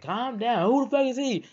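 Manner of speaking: very high, loud mock-female scolding; confrontational mother. Girlfriend in baby dad's face, high-pitched mock confrontation. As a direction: mimic her yelling in his face, high pitch. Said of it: "she in his face like".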